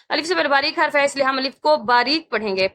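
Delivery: usual speaking voice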